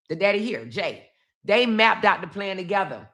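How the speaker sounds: normal talking voice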